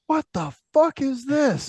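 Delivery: slow, high baffled voice; drawn-out confused outburst. Retold or imitated. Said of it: "I'm just like".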